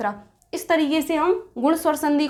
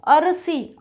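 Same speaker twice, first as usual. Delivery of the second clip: ordinary delivery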